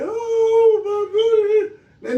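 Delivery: hammed-up grieving wail; mock crying. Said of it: "So now I'm about to sit here and cry".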